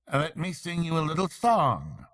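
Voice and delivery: a lightly voiced after-dinner orator; pompous speechmaker. A narrator's reading. Chief Weasel toasting the banquet guests, lightly pompous speech. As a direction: play the smug toastmaster, lightly.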